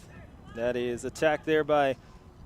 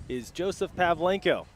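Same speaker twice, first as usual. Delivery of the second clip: ordinary delivery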